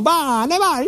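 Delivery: high pushing call of the crowd; crowd urging. Retold or imitated. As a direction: urge him on, high. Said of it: "mi spingevano".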